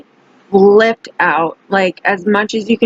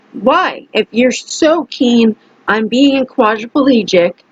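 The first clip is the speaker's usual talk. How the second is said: angry dare; 3 semitones higher than usual. Retold or imitated. Daring the man who wants to be quadriplegic, angry.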